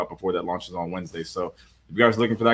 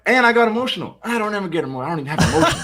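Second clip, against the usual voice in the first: much higher, laughing through it; laughing confession; 11 semitones higher, faster than usual. Real, not acted. Reviewer jokingly confessing he got emotional over the film, high and laughing. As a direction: confess it while cracking up. Admitting getting emotional at movie.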